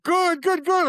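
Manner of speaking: high, loud gleeful repeats; mock neighbor chuckling with delight; gleeful good. Neighbor cheers the sale, gleefully.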